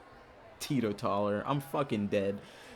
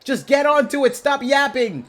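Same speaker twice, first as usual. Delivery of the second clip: impatient yell; 10 semitones higher, 9 dB louder than usual. Real, not acted. Yelling at video.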